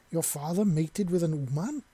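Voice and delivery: a touch of disbelief in the voice; shocked. A narrator's reading. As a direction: let the shock break through the question. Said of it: "with obvious shock in her voice".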